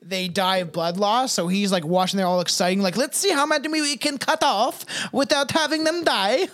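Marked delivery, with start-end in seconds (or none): 3.0-6.5 gleeful villain voice. Pretend. Voicing gleeful pope.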